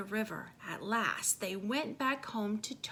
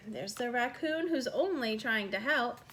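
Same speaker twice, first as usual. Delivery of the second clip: usual speaking voice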